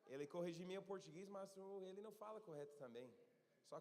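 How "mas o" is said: normal talking voice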